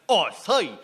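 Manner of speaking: mock Cockney street man, lightly done; a light, hammed-up Cockney accent, like a street trader. [name] imitating a Cockney street man shouting, in a light mock Cockney accent.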